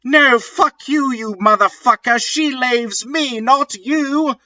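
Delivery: high, fast hammed-up comeback; mock shout. Vampire shouts at Draco, high and fast. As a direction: fire it back quickly up high. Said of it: "shouted Vampire".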